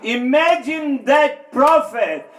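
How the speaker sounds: loud, slowing, rising sermon climax; preaching at full force. Acted. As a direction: drive the point home to the congregation.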